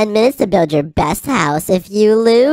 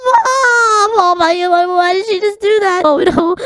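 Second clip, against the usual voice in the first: hammed-up wail; 8 semitones higher than usual. Sister wails as mom leaves.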